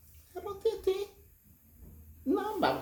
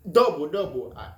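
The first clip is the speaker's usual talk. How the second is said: usual speaking voice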